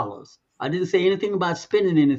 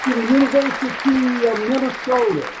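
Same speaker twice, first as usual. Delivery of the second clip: higher, proud announcer delivery, names stretched out; drum-roll announcement; 8 semitones higher than usual. Real, not acted. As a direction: announce it big, drawing out the name. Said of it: "drum, drum roll, please".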